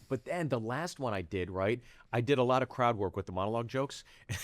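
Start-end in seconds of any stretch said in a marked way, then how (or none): none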